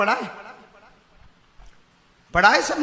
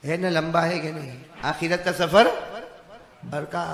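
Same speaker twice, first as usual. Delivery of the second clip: ordinary talk, nothing marked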